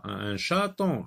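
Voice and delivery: normal talking voice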